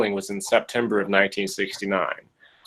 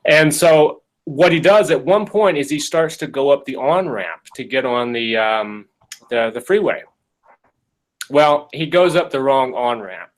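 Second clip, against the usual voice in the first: normal talking voice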